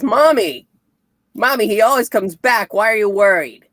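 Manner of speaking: lightly acted child voice; voiced little son. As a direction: call to mommy like a kid. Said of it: "their son".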